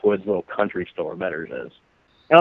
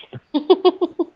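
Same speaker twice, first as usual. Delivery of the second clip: high laugh. Real, not acted. Laughs at own punchline.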